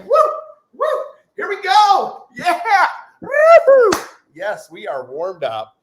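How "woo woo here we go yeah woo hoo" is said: loud, high-pitched whoops; shrieky pep-rally shouting; hype cheering. Acted. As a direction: whoop it up to fire up the crowd. Hyping up the warm-up.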